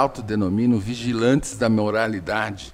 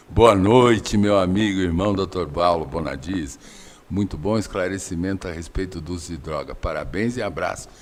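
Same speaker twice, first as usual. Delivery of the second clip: lower, put-on voice for reading a message; disguised deep voice; 5 semitones lower than usual. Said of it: "vou mudar a voz pra ler a mensagem aqui". (voz grave disfarçada)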